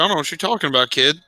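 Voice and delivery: usual speaking voice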